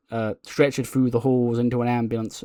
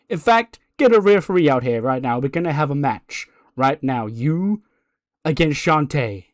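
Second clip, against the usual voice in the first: loud, raised announcement of a match; big authoritative proclamation; commanding announcement; 3 semitones higher, 4 dB louder than usual. Acted. Acted boss orders a match.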